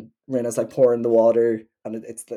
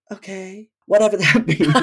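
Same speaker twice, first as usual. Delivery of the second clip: imitating Nene; 6 semitones higher than usual. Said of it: "clip of Nene Leakes where she's like".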